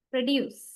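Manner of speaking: produce as a verb, pro-DUCE; stress on second syllable. Shown as a demonstration. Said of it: "That means the verb".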